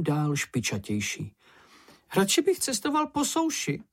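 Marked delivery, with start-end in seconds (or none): 2.2-3.8 very high-pitched little character; high character voice, reluctant; Čenich's uneasy voice. A narrator's reading. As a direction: say it in a small, high voice. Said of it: "řekl Čenich".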